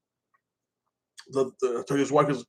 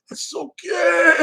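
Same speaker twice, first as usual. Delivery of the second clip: slow, much higher wailing shout; mock shriek; 4 dB louder, much slower than usual. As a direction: wail it out slow like a shocked doll. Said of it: "And Chucky's like".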